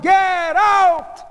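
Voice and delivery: high, loud, long-held bellow; shout. A narrator's reading. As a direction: shout at the whole room. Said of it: "Epstein shouted".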